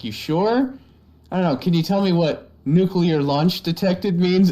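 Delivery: high, playful pressing; teasing coax. Retold or imitated. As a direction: coax them like a prankster. Friend coaxing the kids.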